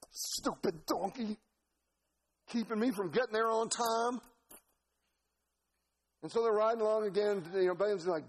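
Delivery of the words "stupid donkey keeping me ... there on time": lightly voiced grumbling yokel, drawn out; grumbling Balaam. Preacher as Balaam grumbling at donkey, mock annoyance. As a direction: scold the animal, a touch higher.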